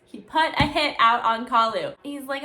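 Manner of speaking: normal talking voice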